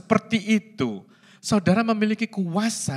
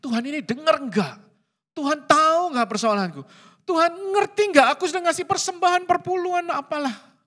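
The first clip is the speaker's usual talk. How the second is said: whiny complaint to God; 9 semitones higher than usual. Pretend. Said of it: "Yang ada dalam dirimu adalah".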